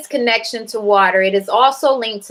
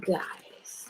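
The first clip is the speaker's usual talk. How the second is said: whispered reading; 15 dB quieter than usual. Real, not acted. Reading the goddess's name to herself, whispered.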